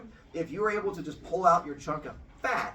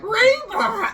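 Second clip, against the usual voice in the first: very high, loud gleeful cry; excited kid shout; 12 dB louder than usual. Pretend. Kid showing mom the rainbow, high gleeful shout. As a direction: shriek it with pure delight.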